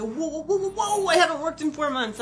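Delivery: high, panicky pleading; a mock-frantic "whoa, whoa" to calm him; flustered protest. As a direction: back him off in a high, rattled voice. Panicked reply to ranting boss.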